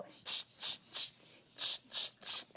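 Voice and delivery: soft voiceless "ch" monkey noises; monkey chatter. A narrator's reading. Storyteller mimicking monkeys for children, chittering.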